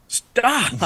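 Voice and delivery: high, exasperated mock plea; helpless protest. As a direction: act out a futile call to make them stop. Acts out telling looters to stop.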